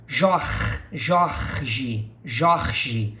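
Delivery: "Jorge" with a raspy h for the R; Rio R as H. Shown as a demonstration. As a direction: scrape the R in "Jorge" into a throaty h. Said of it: "pronounce the R as h".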